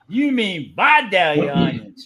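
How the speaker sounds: slow Southern correction. Retold or imitated. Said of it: "He said".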